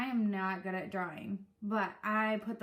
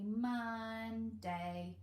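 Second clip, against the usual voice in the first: slow, syllable by syllable; finger syllables. Shown as a demonstration. Showing parents the finger-counting way, slowly. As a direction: count each beat on a finger. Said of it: "raise one finger for each syllable".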